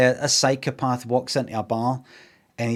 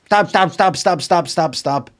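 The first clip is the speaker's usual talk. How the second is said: stab punched out, high and loud; mock stabbing chant; 5 semitones higher, 4 dB louder than usual. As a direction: chant each stab like a knife blow. Acting out the stabbing.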